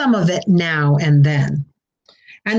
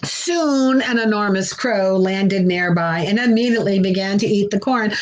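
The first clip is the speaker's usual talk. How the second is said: ordinary delivery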